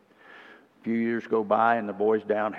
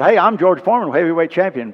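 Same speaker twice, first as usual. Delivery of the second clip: higher, loud proud call; street corner announcement; 7 semitones higher, 10 dB louder than usual. Retold or imitated. George calling to people on the corner, loud and proud. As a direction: call out to passersby.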